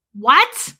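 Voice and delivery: loud, incredulous "what"; retold disbelief. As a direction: blurt it out in disbelief. Disbelieving reply in retold argument.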